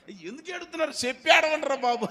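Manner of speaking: high, loud exasperated plea; Moses exasperated. Retold or imitated. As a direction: beg them to stop crying, loudly. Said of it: "అని అన్నాడంట". (మోషే విసుగుతో గట్టిగా అడగడం)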